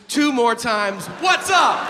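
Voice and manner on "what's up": high, loud "hey" to a crowd; brash shout. Acted. Comic breaking off a list of shootings, brash shout. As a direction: holler it out, high and punchy.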